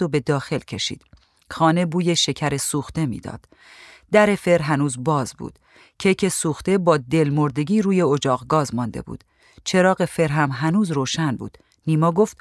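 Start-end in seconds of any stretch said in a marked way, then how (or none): none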